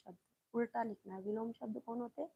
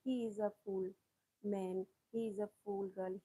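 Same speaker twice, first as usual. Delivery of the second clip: slow example sentence; much slower than usual. Shown as a demonstration. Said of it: "अरे ये तो बड़ा मूर्ख है".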